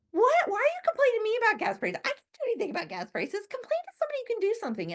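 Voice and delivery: hammed-up exasperation at a complainer; exasperated rant. Exasperated reply to gas complainers.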